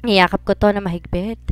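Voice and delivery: plain reading voice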